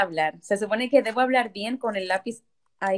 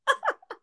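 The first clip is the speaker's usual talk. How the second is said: high laugh. Real, not acted. Laughs at the joke.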